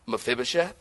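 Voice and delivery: soft questioning "Mephibosheth?"; gentle call. Shown as a demonstration. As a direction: ask the name tenderly. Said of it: "tone is everything".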